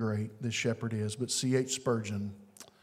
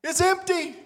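exultant; 6 dB louder than usual. Acted. Exultant preaching climax.

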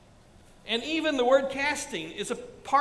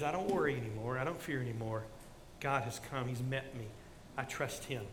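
soft, low, hushed trust; quiet testimony; 9 semitones lower, 10 dB quieter than usual. Retold or imitated. Pastor quoting his mother's peace, soft and low. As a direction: speak it gently, at rest.

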